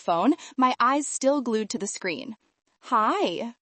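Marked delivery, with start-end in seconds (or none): none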